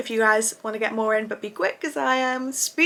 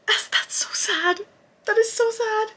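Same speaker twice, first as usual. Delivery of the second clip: whispered sad lament; 11 semitones higher than usual. Retold or imitated. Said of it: "And I was like".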